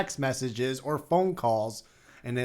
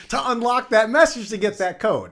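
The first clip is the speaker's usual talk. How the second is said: loud, high incredulous yell; worked-up, high-pitched protest; exasperated rant; 9 semitones higher, 8 dB louder than usual. Real, not acted. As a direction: yell out the absurd catch. Exasperated rant continues after laughing.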